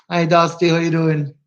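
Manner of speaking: usual speaking voice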